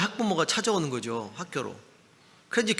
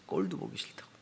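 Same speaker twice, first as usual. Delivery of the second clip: whispered echo; 10 semitones lower, 9 dB quieter than usual. Retold or imitated. Echoing the teacher's cutting words.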